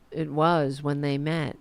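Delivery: ordinary delivery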